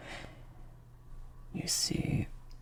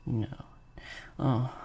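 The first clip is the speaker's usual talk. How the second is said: normal talking voice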